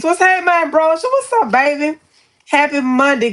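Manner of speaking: high, bright hello to a friend; excited greeting. Real, not acted. Streamer greeting a viewer who just joined, excited high hello. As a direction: sing out a delighted hello.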